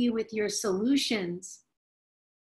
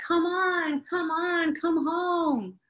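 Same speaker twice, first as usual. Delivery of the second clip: put-on high child calling out; higher child's voice, rising to a call; 8 semitones higher than usual. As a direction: call them home from the throne. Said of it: "inner child ... says".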